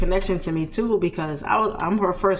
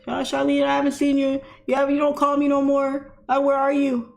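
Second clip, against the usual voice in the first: imitating grandma; 9 semitones higher than usual. Grandma on the phone to her granddaughter, higher imitating voice. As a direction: voice grandma wondering where you've been.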